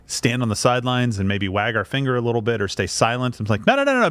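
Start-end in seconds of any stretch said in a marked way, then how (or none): 3.7-4.1 high, louder string of nos; lightly acted objection, raised pitch; mock protest. Mock scolding of Israel voiced.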